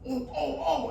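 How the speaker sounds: high, hopeful gasping oh's; sudden hope from the bottom of the pit; trapped tiger hears footsteps, high excited oh's. Acted.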